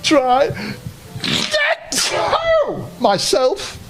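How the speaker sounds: a small toy minding his manners; high toy bear voice, politely; teddy bear voice. Acted. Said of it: "said the teddy bear".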